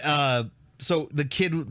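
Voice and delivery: ordinary delivery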